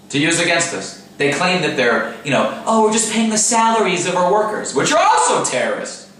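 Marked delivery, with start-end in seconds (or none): 2.7-4.7 mocking. Mocking the enemies' claim.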